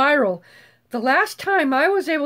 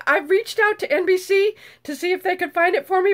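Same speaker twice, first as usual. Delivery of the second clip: ordinary talk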